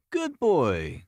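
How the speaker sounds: plain reading voice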